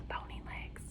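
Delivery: hushed spooky whisper of the name; whispered warning. Acted. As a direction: breathe the name like a secret. Spooky hint to children.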